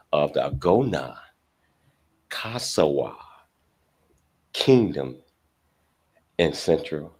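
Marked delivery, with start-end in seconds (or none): none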